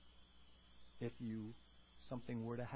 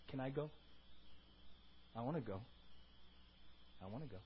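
soft, low acted plea; hushed and low, wanting to come along; quiet longing; 4 semitones lower, 11 dB quieter, faster than usual. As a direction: murmur it as a wistful request. Softly voices outsiders longing for heaven.

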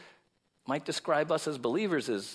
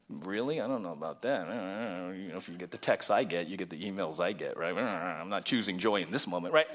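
doubting inner voice; 12 semitones lower, 7 dB quieter than usual. Retold or imitated. His own doubting inner voice.

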